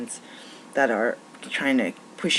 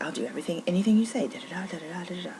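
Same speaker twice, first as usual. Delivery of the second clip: eager worker; 5 semitones higher, faster than usual. Retold or imitated. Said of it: "They'll be like".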